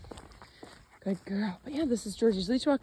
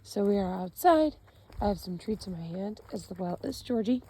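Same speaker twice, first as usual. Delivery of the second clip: usual speaking voice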